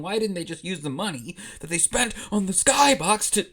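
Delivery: building to a loud, high-pitched outburst; rising indignation, punching the last words; exasperated rant. Real, not acted. Narrator fed up with the skybox plot hole, exasperated.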